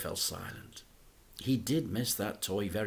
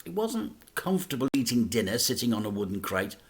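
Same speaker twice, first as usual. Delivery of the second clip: ordinary delivery, nothing marked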